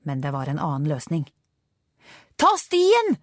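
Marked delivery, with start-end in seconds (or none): 2.4-3.2 high, loud directing cry; urgent shout. A narrator's reading. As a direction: shout it into the driver's helmet. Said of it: "ropte han inn mot hjelmen".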